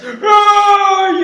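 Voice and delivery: chillido agudo y fuerte; grito de monstruo. Acted. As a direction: grita ofendido. (monstruo chilla)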